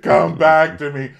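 hammed-up heartbroken plea; mock longing. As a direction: wail to a lost cigarette like a lover. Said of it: "It's like".

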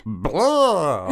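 playful vampire blah; hammed-up vampire voice; Bela Lugosi voice. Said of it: "Bela Lugosi and spooky voices".

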